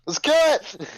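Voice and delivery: loud, high-pitched battle cry; excited high yell; in-character scream. Acted. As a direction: scream it out, half laughing. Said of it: "on God scream that out".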